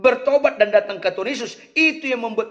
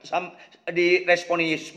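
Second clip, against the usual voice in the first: normal talking voice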